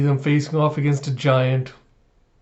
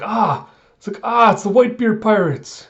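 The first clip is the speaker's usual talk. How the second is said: startled cry; 7 semitones higher, 4 dB louder than usual. Retold or imitated. Oden startled at Whitebeard's crew.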